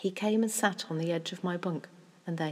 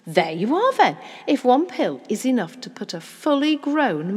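loud, emphatic explanation; animated father pleased with his logic; triumphant father; 4 semitones higher, 8 dB louder than usual. Acted. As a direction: drive the point home with relish.

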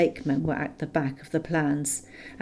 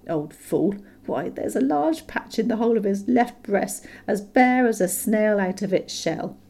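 inner thought; 5 semitones higher than usual. A narrator's reading. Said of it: "what he thought inside was".

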